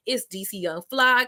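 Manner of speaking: ordinary talk, nothing marked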